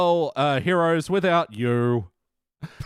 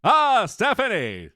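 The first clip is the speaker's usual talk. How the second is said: the Tick impression; 6 semitones higher, slower than usual. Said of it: "This is more of the tick".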